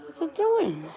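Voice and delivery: high cooing question. Real, not acted. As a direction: coo at the cat. Cooing at cat.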